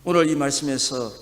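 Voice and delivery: ordinary talk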